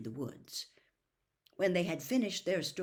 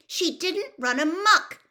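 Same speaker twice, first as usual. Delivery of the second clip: much higher, louder shout; 13 semitones higher, 12 dB louder than usual. A narrator's reading. Hermione shouting at Percy in defence, shouting. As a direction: shout him down. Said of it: "shouted Hermione".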